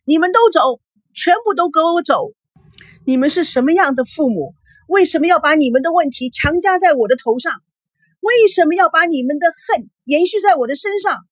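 high, anguished shouting; wailing outburst. A narrator's reading. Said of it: "大哭大叫起来".

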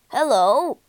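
loud, merry greeting; cheerful call. A narrator's reading. Toad greets his friends at the door, calling cheerfully. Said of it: "he called cheerfully".

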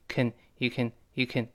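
"can" reduced to a quick "kn"; weak form. Shown as a demonstration. Teacher shows the reduction, weak form. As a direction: pass lightly over CAN in "you can". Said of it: "还是弱化成can".

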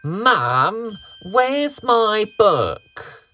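put-on high child's voice shouting; little boy shouting. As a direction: call out like a frustrated boy. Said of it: "Each time he'd shout".

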